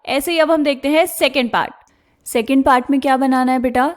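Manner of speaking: normal talking voice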